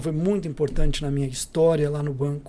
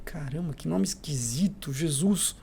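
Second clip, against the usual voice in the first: muttered aside; 5 dB quieter, slower than usual. Retold or imitated. Intern muttering to himself on phone.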